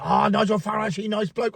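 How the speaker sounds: light imitation of a Chinese accent, clipped "he nice bloke"; mock Chinese accent. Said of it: "I did the accent".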